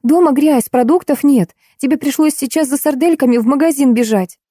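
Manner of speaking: high, indignant scolding; boiling over. A narrator's reading. Julia berating her relative over housework, boiling with indignation. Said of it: "вскипела Юлечка".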